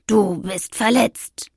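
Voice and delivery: slow, unimpressed cat voice. A narrator's reading. Cat observing the witch's injury, unimpressed. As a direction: state it slowly, wholly unbothered. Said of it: "stellte er unbeeindruckt fest".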